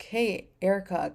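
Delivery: usual speaking voice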